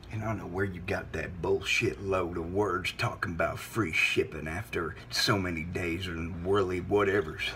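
deep, quiet, controlled scolding; low menace. Acted. Host disputing caller's shipping claim, low menacing voice. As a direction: keep it very low, a simmering warning.

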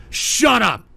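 short angry yell; real angry shout. Real, not acted. Host silences a chatter disputing his story, shouting angrily. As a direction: shout down the heckler. Snaps at chatter calling him liar.

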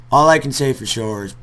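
ordinary talk, nothing marked

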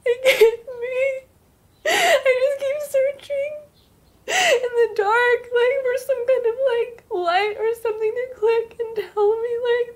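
tearful rambling, voice breaking; crying. Acted. Sister breaks down crying over her ex-boyfriend David. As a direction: sob it out, words tumbling.

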